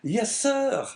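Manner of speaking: high, surprised innkeeper; surprised host's high-voiced reply; surprised answer. A narrator's reading. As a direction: answer with surprise, pitched well up. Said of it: "even more surprised at the question".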